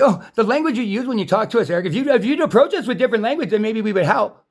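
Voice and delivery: high, prissy imitation of scolding relatives; mocking family. Ranter imitates his family lecturing him about language. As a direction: mimic them, nose in the air. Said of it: "And they say".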